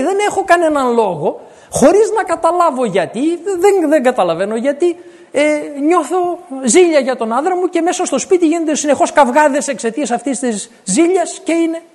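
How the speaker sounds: a hint of a bewildered woman pleading; confused wife. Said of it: "λέει".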